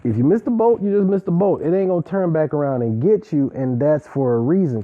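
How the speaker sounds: normal talking voice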